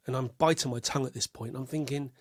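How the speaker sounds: ordinary talk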